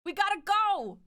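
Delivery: high, loud mock panic; frantic, hammed-up game character voice; panicky character. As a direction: squeal it in exaggerated alarm. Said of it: "But then she's also like".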